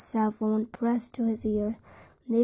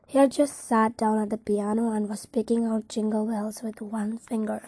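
usual speaking voice

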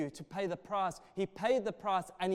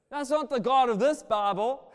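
laughing; 3 semitones higher, 7 dB louder than usual. Real, not acted. Laughing while preaching.

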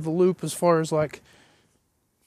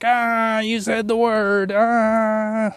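a hint of nasal Pee Wee shriek; Pee Wee Herman voice; 3 semitones higher, 5 dB louder, much slower than usual. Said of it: "sound like a Pee Wee Herman".

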